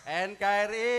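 loud, high slogan call; rallying shout. Real, not acted. Speaker leading the crowd in a slogan, rallying shout.

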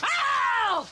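sharp high yelp; yelp of pain. Real, not acted. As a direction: cry out in sudden pain. Doctor gets licked or bitten.

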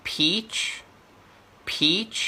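"peach" with long rising EE, then CH; longer vowel. Shown as a demonstration. Tutor drills the second word for students, longer vowel. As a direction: stretch the EE in "peach", pitched high. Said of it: "อันนี้เสียงจะยาวขึ้นนะ".

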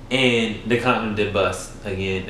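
ordinary talk, nothing marked

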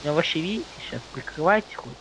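ordinary talk, nothing marked

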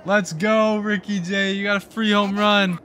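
high, celebratory holler; jubilant cheer. Real, not acted. Gamer celebrating Ricky J's home run, jubilant cheer.

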